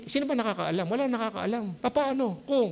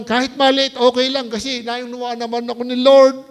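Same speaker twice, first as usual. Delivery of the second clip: preaching at full force; 7 dB louder than usual. Acted.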